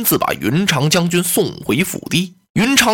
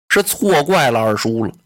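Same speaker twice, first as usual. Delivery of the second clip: usual speaking voice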